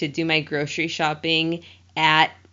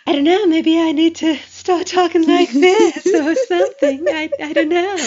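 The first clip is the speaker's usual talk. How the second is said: high, loud, bright put-on voice; 9 semitones higher, 6 dB louder than usual. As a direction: pitch the voice way up and talk louder. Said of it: "I vote for that voice".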